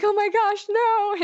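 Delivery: high-pitched mock-horrified gasp of words; retold dismay. Woman retelling her horror at her date's teasing, mock-horrified. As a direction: act out an embarrassed shriek, not too loud.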